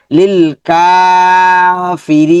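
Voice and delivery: slow recitation of লিল কাফিরি with held কা; long কা and রি. Shown as a demonstration. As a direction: stretch কা and রি, keep ফি short. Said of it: "কাটা লম্বা হবে".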